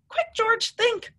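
high, urgent narration. A narrator's reading. Narrator urging George to think fast. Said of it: "George had to think of something else".

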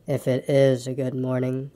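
low, slow, doubting donkey; gloomy. A narrator's reading. Eeyore doubts Pooh's greeting, slow and gloomy. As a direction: draw it out low and dejected. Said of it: "said Eeyore gloomily".

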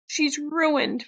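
high, distressed narrated sob; understated tearful wail; sobbing. A narrator's reading. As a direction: lament it with a touch of sobbing. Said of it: "Veronica sobbed".

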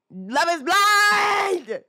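high, loud, drawn-out yell; exasperated high-pitched holler; exasperated shout. Real, not acted. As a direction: yell it, stretching "blind" right out. Exasperated reminder of show's premise.

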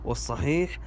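normal talking voice

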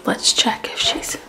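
soft unvoiced whisper. Real, not acted. Checking if baby asleep.

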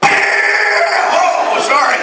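high, loud startled yelp from the recoil; mock startled shout. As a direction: yelp like the gun just kicked you. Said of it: "you're just like".

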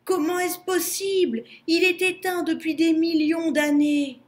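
high, alarmed wail with a gasped "oh"; frightened baboon in full alarm; panicked moan. A narrator's reading. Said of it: "gémit le babouin affolé".

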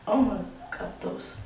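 ordinary talk, nothing marked

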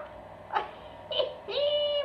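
very high, drawn-out gleeful squeal; delighted squeal. Real, not acted. Delighted by what is inside.

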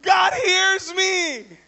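high, loud cry of joy; wife overcome, crying out. Retold or imitated. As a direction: mimic the wife's tearful outburst. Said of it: "And my wife's crying".

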